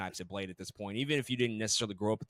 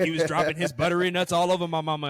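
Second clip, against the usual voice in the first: hammed-up Southern Louisiana Cajun accent, louder; overdone fake Cajun accent, louder; mock Cajun accent; 4 semitones higher, 7 dB louder than usual. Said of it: "how you're talking like this".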